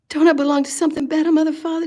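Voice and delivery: pleading. Real, not acted. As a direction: reach up and beg to belong. Pleading to Mother, Father.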